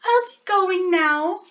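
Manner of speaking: a tiny mouse politely angling for tea; polite little mouse. Acted. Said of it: "said the mouse".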